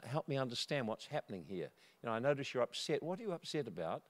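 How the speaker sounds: soft, calm questioning; hushed, reasoning tone; speaking quietly. Retold or imitated. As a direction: gently try to calm an angry neighbour. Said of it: "spoke quietly".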